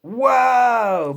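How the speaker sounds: ordinary delivery, nothing marked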